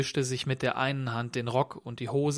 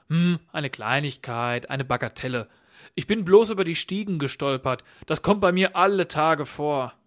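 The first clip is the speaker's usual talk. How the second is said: lightly voiced odd stranger; 3 semitones higher, 6 dB louder than usual. A narrator's reading. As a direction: play down the mishap, growing more emphatic. (leicht gespielter sonderbarer Gast, abwiegelnd)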